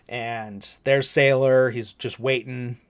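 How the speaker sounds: ordinary talk, nothing marked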